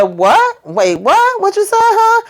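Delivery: shrill high mock shock; mock disbelief. [name] hearing Q is still there, mock shocked.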